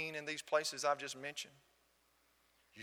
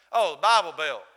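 much louder, quoting the other man; loud retold exclamation; 12 dB louder than usual. New Englander reacting to the preacher's home state, loud retold exclamation. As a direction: imitate a loud, knowing interjection.

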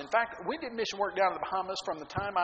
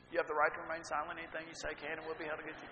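mock arrest rights; 3 semitones lower, 7 dB quieter, faster than usual.

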